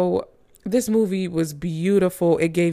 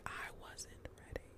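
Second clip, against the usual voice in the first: breathy whisper, half to herself; whispered aside; 27 dB quieter than usual. Real, not acted. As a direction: let it slip as a secret aside. Aside after praising the movie.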